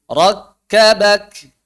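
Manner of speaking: Rakkabak stretched slowly with clear k; slow model reading. Shown as a demonstration. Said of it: "model reading". (bacaan contoh pelan)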